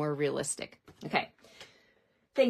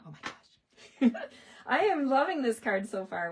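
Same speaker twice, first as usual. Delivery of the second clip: giggling, excited gush over the card; real laughing joy, louder and higher; laughing delight; 5 semitones higher, 5 dB louder than usual. Real, not acted. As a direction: laugh through the words, thrilled.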